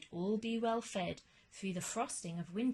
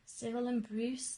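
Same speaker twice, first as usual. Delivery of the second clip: plain reading voice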